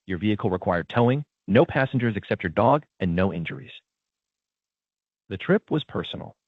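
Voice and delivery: ordinary delivery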